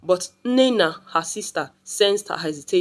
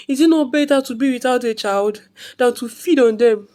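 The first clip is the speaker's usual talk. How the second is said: high, loud anguished wail; 6 semitones higher, 4 dB louder than usual. Acted. Mother wailing to her sister after losing another child. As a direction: wail out the question in despair.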